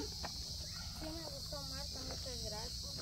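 soft, slow, barely-there thank you; shy thanks. Real, not acted. Thanking Marta for the gift, soft and slow. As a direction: mumble your thanks shyly.

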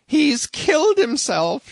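very high, loud, slow wail; landlady crying out. A narrator's reading. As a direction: cry it out in horror. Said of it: "she cried".

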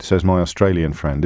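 ordinary talk, nothing marked

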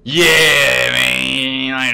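loud excited yell; excited shout. Real, not acted. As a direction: shout it out in delight. Sudden shout of recognition.

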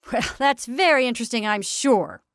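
loud, indulgent, patronizing reply; tolerant. A narrator's reading. Attorney humours the ladies' quilt talk, tolerantly. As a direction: humour them, voice lifted then falling. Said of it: "he said tolerantly".

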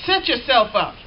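ordinary delivery

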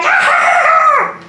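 high mock goblin scream; goblin screech. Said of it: "like, goblin going".

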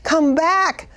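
high, slow pleading; pleading cry. Retold or imitated. Preacher retelling his plea for the girl, high and slow. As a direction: stretch it out, begging her back. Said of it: "And I kept crying".

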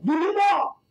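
high, loud shouted demand. Retold or imitated. Tall opposing player demanding the ball mid-game, loud shout. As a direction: bark for the ball like a bully.

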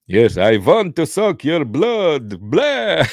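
put-on campy Eastern European vampire accent. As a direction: drag it out like a cartoon Count, then a high "Blah". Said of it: "Well, Eastern European accent".

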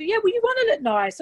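high, defiant retort; indignant. Real, not acted. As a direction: push back, pitched right up. Indignant retort after mocked quote.

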